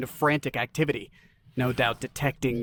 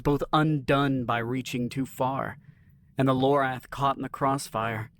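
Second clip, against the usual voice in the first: ordinary delivery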